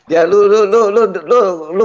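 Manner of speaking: high mocking singsong lu lu lu; teasing singsong. Real, not acted. Teasing Madia in voice chat, high mocking singsong. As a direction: taunt him like a playground chant.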